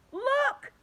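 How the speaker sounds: high excited cry of Look; sudden high cry pointing something out. A narrator's reading. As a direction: shout it out in alarm. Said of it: "cried the centipede".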